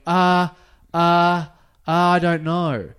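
stall, lost for words; nervous stammer. Retold or imitated. Stammering at police.

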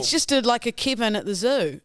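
ordinary talk

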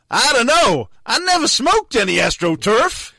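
put-on high-pitched, irritable American accent; exasperated American accent. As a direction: do a wound-up American comeback. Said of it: "only got one American accent".

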